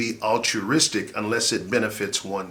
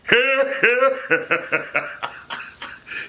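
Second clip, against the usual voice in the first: high laugh; 12 semitones higher than usual. Real, not acted. Laughs at own demo.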